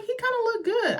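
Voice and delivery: very high, appreciative squeal of approval; impressed admiration. Retold or imitated. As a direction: say it high, pleasantly astonished. Admiring the grown-up actor.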